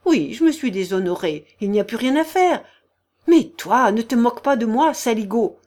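heated tirade, punched loud with jumps in pitch; indignant ranting woman. A narrator's reading. The general's wife berating the whole company, indignant. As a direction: rail at them in outrage.

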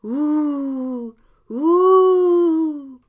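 long rising ghostly hoot, growing loud; guttural ghost moan. A narrator's reading. Ghost at the fence moaning at Dominique, long guttural hoot. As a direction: moan like a sheet ghost scaring a passer-by. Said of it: "d'une voix des plus gutturales".

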